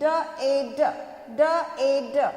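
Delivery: ड ए ड in short separate sounds; letter sounds. Shown as a demonstration. Teacher drilling children, ड ए ड said sound by sound. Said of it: "क्या बोलेंगे".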